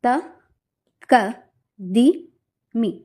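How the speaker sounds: one syllable per beat, slow and spaced apart; first speed recitation. Shown as a demonstration. Teacher demonstrating first speed of the jathi, slow and even. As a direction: place each syllable alone on its own tap: త, క, ది, మీ.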